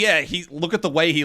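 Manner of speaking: ordinary talk, nothing marked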